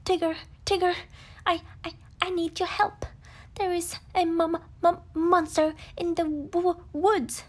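panicked little pig begging for help; frightened squeal. Acted. Piglet begs Tigger for help in a stuttering squeal. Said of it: "Piglet squealed".

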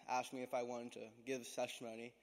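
ordinary delivery

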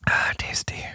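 breathy whispered aside after sipping. Real, not acted. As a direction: whisper it, savouring the drink. Sipping a drink on mic.

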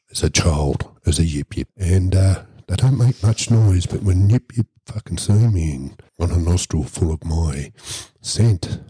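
ordinary talk

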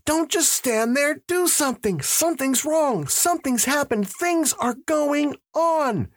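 hysterical, sustained high-pitched cry; acted panic at top pitch, volume contained; frantic scream. A narrator's reading. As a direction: keep screaming at unresponsive onlookers, pitch pinned high. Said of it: "he screamed".

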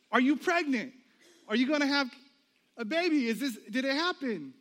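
high, loud, excited prodding; eager questioning. Retold or imitated. Friend asking newlyweds about a baby, excited and insistent. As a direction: burst out with hopeful questions in short bursts.

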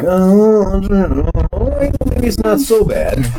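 high, drawn-out mock reconsidering; grudging concession. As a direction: stretch it high and slow, reluctantly coming round.

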